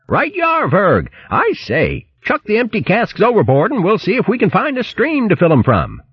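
high, chirpy hare voice calling back. Acted. Hare calling back to the ship, high hearty voice. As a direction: answer brisk and obliging, pitched well up.

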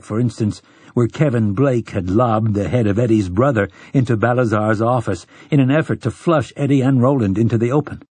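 plain reading voice

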